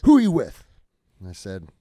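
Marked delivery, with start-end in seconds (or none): 0.0-0.7 loud angry shout; mad. Retold or imitated. Sergeant major demanding their unit, furious shout. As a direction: demand it furiously, louder still. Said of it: "Like, all mad".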